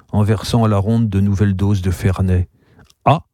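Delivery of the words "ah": a short, uninterested "ah"; indifferent. A narrator's reading. Customer answering the innkeeper's claim, indifferent. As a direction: shrug it off in one syllable. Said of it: "fait le type avec indifférence".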